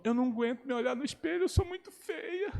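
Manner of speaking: put-on high, soft and slowing, a hurt girl; sad girl. Girl telling the pastor she feels ugly, high and soft. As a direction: let her sink into self-pity. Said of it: "Ela falou".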